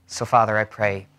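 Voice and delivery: low, soft, measured prayer. Real, not acted. Pastor prays with the congregation before the sermon, low and soft. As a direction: lead them in quiet, reverent prayer.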